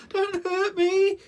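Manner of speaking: very high, loud, slow frightened whimper; whimpering lion. Acted. The scared lion begging the mouse, whimpering. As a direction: plead in terror. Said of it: "he whimpered".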